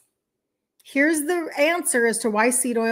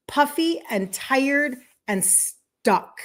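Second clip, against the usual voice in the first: each symptom said slowly; unhurried symptom list; 3 semitones higher, much slower than usual. Shown as a demonstration. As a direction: lay out puffy, tired, stuck one by one. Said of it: "slow listing of symptoms".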